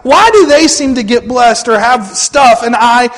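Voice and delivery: loud, high-pitched questioning of God; aggrieved complaint. Acted. Acts out believer's complaint to God.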